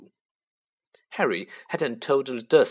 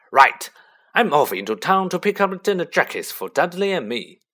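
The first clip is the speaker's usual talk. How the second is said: sudden loud bark, higher; booming bossy uncle; 5 semitones higher, 6 dB louder than usual. A narrator's reading. Uncle barking orders.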